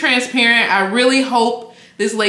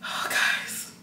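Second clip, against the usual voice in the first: breathy whisper; 13 dB quieter than usual. Real, not acted. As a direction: whisper it in awe. Overwhelmed, breath out.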